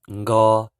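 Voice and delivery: "ngo" loud with nasal start; teacher drilling nk syllable; Hmong nko. Shown as a demonstration. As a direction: clearly say "ngo". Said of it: "N K is pronounced".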